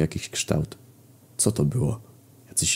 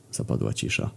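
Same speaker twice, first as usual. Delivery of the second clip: ordinary delivery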